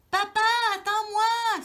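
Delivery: high, loud call to a father; little mouse calling out loud; crying out. A narrator's reading. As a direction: shout after him so he stops. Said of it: "s'écrie-t-il".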